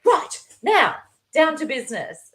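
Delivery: high, brisk all-business voice; brisk colleague. Retold or imitated. Stressed colleague cutting to business, high and brisk. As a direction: snap straight into business. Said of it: "You know, just".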